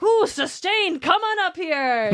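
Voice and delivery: slow, high-pitched showy welcome; playful announcement. Retold or imitated. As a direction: imitate improvisers in a high, drawn-out mock ruling. Retold improvisers playing along.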